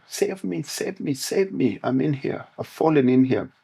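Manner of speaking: a trapped man's high-pitched mock plea; mock cry for help. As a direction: call up from the hole, begging to be saved. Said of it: "And he starts shouting".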